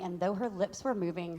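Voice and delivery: ordinary talk, nothing marked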